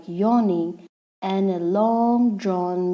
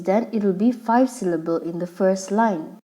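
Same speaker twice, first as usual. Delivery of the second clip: ordinary talk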